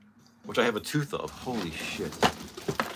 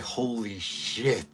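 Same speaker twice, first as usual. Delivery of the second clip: scared shark murmur; 7 dB quieter, much slower than usual. Retold or imitated. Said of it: "but it's like".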